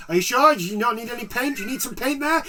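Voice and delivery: high, eager salesman pestering; hammed-up clerk pushing paint; pushy shop assistant. Mocking pushy shop staff.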